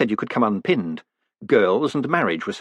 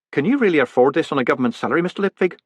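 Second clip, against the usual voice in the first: teasing woman; 5 semitones higher, 3 dB louder, faster than usual. A narrator's reading. Narrated dialogue, Miss Dearheart.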